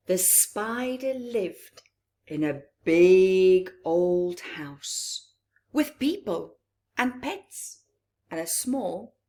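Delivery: lilting storybook read-aloud; gentle bedtime-story telling; reading to children. A narrator's reading. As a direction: read it to a room of little ones. Reads picture book aloud.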